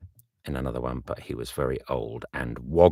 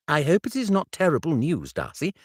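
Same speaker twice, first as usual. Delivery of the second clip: a hint of a higher, eager Bingley; young friend asking kindly; Bingley, lightly raised; 12 semitones higher, 6 dB louder than usual. Bingley asks Darcy about the letter, lightly higher.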